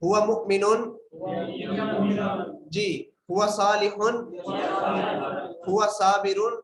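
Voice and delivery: called out loud for the class to answer; teacher calling out a drill word; drill prompt. Shown as a demonstration. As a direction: project the masculine form so students reply. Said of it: "मैं पढ़ूंगा मुजक्कर के लिए".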